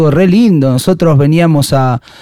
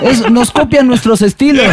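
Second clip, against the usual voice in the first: higher, laughing mock punk; imitating the punk; 8 semitones higher than usual. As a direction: quote him while cracking up.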